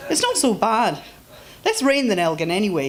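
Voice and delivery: lightly voiced Scot, easy and reasonable; a hint of a Scot reassuring her; reassuring Scottish man.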